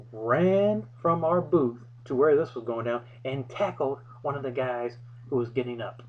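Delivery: ordinary delivery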